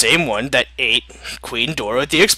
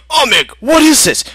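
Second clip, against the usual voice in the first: high surprised cry; 9 semitones higher than usual. Acted. Alien returning to Earth, shocked high squeal.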